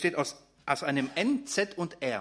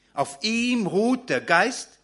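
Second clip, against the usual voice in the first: slow, louder, higher, every word weighted; solemn emphasis; 6 semitones higher, 5 dB louder, slower than usual. Acted. Preacher proclaims Isaiah's promise to the congregation, slow and emphatic. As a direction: let the promise ring out to the church. Preacher repeats key verse.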